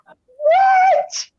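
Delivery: high-pitched squeal of disbelief; incredulous what. Retold or imitated. Reacting to mom's shark panic, incredulous squeal. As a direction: shoot way up high in mock shock.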